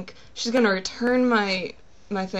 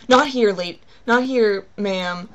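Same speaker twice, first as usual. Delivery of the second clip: pissed-off clerk, loud and brusque; retold angry outburst, louder; 8 dB louder than usual. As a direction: imitate an irritated, raised-voice brush-off. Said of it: "looking pissed".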